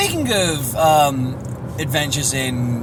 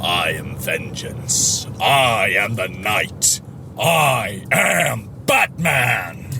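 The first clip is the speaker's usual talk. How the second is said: very low, slow gravel-free growl of Batman; deep brooding hero, drawn out slowly; low slow Batman impression; 6 semitones lower, much slower than usual. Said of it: "Go on".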